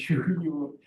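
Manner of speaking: normal talking voice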